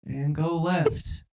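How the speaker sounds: comic presidential impersonation; Joe Biden. Acted. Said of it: "I'm just Joe Biden on the track".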